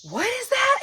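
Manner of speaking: very high, loud mock alarm; alarmed suspicion. Imitating a friend eyeing suspicious salt, high alarmed squeal. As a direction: squeal it as if offered poison. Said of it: "like I was trying to poison her".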